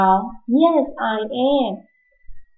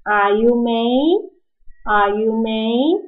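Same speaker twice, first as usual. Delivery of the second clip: very slow, with the pitch climbing on "May"; slow teacher demo of "Are you May?"; rising question; slower than usual. Shown as a demonstration. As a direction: drag it out and rise at the end. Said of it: "怎么问".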